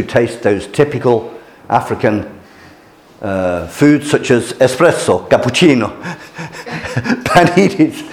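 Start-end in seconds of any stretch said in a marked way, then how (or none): 6.0-8.0 laughing through the word paninis. Real, not acted. Teacher laughing at a funny shop sign. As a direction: chuckle it out, amused at the joke.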